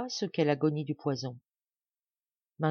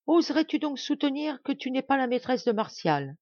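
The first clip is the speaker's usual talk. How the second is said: higher, accusing challenge; jealous accusation; 8 semitones higher, 3 dB louder than usual. A narrator's reading. Madame Blanche accusing Marianne of being Martial's mistress, higher. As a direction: dare her to deny it.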